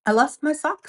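lightly voiced fox; Fox's voice. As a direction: play the fretful little fox. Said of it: "said Fox".

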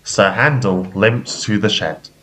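ordinary delivery

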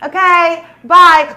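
one last forced sweet bye; fake cheerful goodbye. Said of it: "I went just like this in the car".

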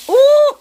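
sudden high, loud yelp; startled yelp. Real, not acted. Startled shriek while stepping near the nest. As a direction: jump at a fright with a shriek.

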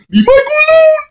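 very high, loud squealed plea; mock desperate scream. Defending poor Michael online, hammed-up scream.

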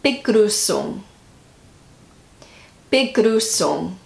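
Begrüßung sounded out slowly, aiming for German ü; attempted German pronunciation. Shown as a demonstration. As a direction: try Begrüßung carefully, drawn out. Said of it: "this word is".

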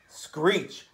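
ordinary delivery

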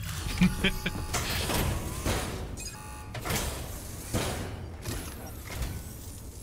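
high breathless giggle; mischievous giggle. Real, not acted. Gloating after planning to rob.

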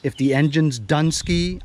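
normal talking voice

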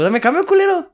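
very high, loud shouted repeat; angry shout. Retold or imitated. Tourist shouts at the vendor for his change, now furious. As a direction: yell it this time, fed up.

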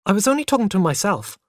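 hurried, slightly afraid self-defence; quick reply. A narrator's reading. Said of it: "I replied quickly".

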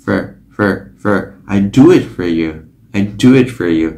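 "for" as an r-colored fer, American English; American reduced for. Shown as a demonstration. Teacher demonstrating American English: r-colored fer. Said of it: "Còn tiếng Anh Mỹ là for".

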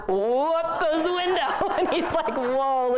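high, laughing through the punchline. Real, not acted. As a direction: crack up while telling your own story. Telling her funny car-window story.

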